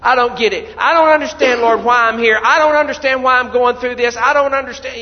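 high, loud plea to God; pleading cry. Acted. Someone on their knees after failure, crying out to God. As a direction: beg the Lord for an answer. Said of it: "get out on your knees".

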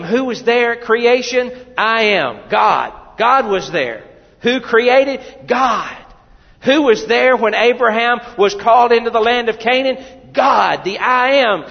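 loud, high sermon climax; preaching. Acted. Pastor rousing the congregation, loud and high. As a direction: preach it with rising fire.

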